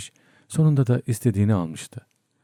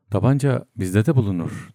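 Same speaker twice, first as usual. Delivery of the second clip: plain reading voice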